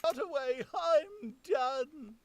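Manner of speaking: high, slow, pleading tramp voice; a rattled vagrant begging to be left alone; bewildered old tramp. Acted. Retake of the tramp line.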